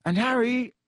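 high, halting, strained voice; falter, then push on while holding it together; worried, hesitant calling; trying to sound steady. A narrator's reading. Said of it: "trying to keep his voice steady".

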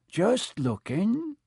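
high, slow, evasive reply; shifty. Acted. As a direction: dodge the question slowly. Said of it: "he said in a shifty voice".

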